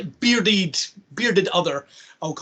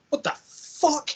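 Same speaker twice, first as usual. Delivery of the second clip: slow, high-pitched disbelief; retold shock; 10 semitones higher, slower than usual. Viewer reacting as he shoots her, retold shock. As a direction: stretch it out high, aghast.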